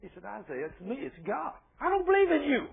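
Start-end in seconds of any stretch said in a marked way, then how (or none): 0.2-1.5 soft, patient reassurance; gentle voice of God. Retold or imitated. God answering Isaiah's question, softly and patiently. 1.8-2.7 very high-pitched mock protest; defiant denial. Isaiah rejecting God, high-pitched defiant retort.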